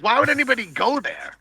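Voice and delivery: high-pitched baffled protest; incredulous question. Retold or imitated. Diver asking why anyone would go in there, incredulous. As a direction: ask it way up high, in disbelief. Recounting his heated disbelief.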